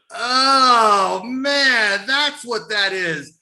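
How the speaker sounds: high, loud cry of realization, slowing at the end; loud amazed realization. Retold or imitated. Wrestlers finally realizing where the smell came from, loud and amazed. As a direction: shout it like it all suddenly makes sense.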